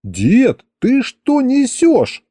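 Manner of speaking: high, drawn-out indignant cry; outraged yell. A narrator's reading. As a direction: yell at grandpa in disbelief, voice leaping up. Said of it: "заорал я". (возмущённый вопль)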